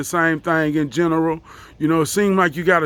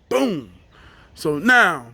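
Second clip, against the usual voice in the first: ordinary talk, nothing marked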